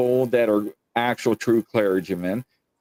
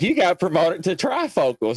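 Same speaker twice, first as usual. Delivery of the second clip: mock doctor; 7 semitones higher than usual. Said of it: "They're like".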